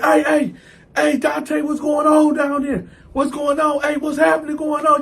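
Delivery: high-pitched holler down the tier; calling out. Retold or imitated. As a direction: imitate inmates calling to find out what's happening.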